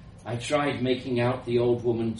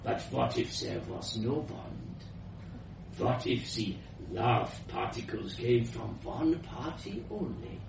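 put-on soft, foreign-accented old scientist; conspiratorial musing; 8 dB quieter than usual. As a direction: pose the idea in a hushed voice. Scientist reveals her secret idea.

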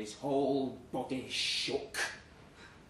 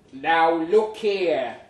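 louder, very slow; drawn-out loud warning to the rival; stern warning; 2 semitones higher, 10 dB louder, much slower than usual. Retold or imitated. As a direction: lay down the law word by word. Squaring up to rival.

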